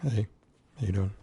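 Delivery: slightly scratchy, rough throat. Real, not acted. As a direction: talk through a scratchy throat. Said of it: "I have a scratchy voice at the moment".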